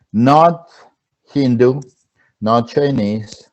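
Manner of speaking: ordinary talk, nothing marked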